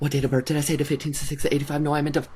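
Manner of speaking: put-on Irish accent, quick and flustered; rattled-off Irish-accent impression. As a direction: backtrack in a Northern Irish accent. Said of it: "how she gets her accent".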